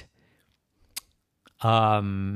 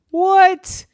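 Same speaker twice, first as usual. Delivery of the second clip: very high, loud squawk of disbelief; astonished; 9 dB louder than usual. Acted. Pudding hears the planet's number name, astonished. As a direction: shoot it up high in shock.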